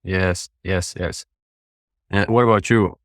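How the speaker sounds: ordinary talk, nothing marked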